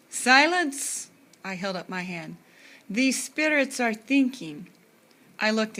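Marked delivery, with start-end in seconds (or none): 0.1-1.1 commanding. Acted. Playing a diviner, commanding. 2.9-4.7 slow, higher, louder Hungarian diviner voice; theatrical Hungarian seer, slow and grand; Hungarian accent. Acted. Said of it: "Miss Sadie's Hungarian accent".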